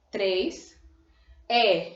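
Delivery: normal talking voice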